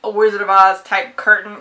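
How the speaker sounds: spring the twist on the readers like a prank; mock gleeful reveal. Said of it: "so she was like".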